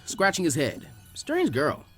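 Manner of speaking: usual speaking voice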